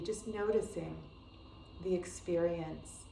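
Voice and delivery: calm, unhurried meditation voice with long pauses; guided meditation. Acted. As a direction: gently guide a beginner into stillness, leaving space between phrases.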